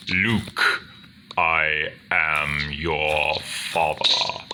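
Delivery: slow, ominous movie-villain bass; James Earl Jones. Acted. Villain revealing he is the father, slow booming bass. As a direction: intone it slowly, with weighty pauses. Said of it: "imitate Star Wars rather easily".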